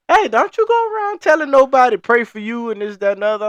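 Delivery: churchgoing lady telling off the speaker, mock high old lady voice; old church lady. Old lady warns him.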